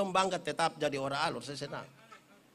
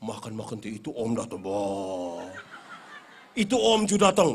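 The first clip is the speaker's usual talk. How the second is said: lower, mock-stern mother warning her child; mother's scolding quote; 11 semitones lower, 3 dB louder than usual. Mother warning her son that the uncle will come, mock-stern. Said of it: "Dia punya mama bilang".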